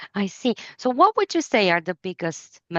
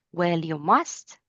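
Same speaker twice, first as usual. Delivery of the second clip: usual speaking voice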